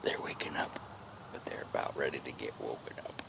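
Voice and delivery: hushed sneaking whisper; dad creeps up on the nest, whispering. Real, not acted. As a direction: whisper so the wasps won't hear. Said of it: "whispering while hunting wasps".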